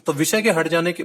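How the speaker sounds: ordinary delivery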